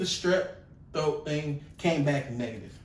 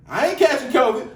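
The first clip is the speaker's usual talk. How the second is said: mock defiant sister; 7 semitones higher, 8 dB louder than usual. Retold sister's answer.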